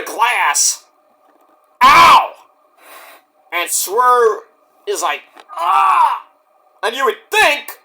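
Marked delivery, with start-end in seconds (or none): 1.8-2.3 loud, high "ow"; sudden hammed-up cry of pain; mock pain yelp. Vicarious pain at glass bump. 5.5-6.3 high drawn-out mock scream; hammed-up "ah" of agony; imitated pain cry.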